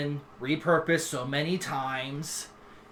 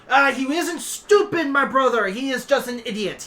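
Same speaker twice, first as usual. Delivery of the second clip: emphatic dragon head; 10 semitones higher, 7 dB louder than usual. Acted. Dragon head corrects his brother about Reiki, high and insistent. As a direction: correct him with raised, insistent voice.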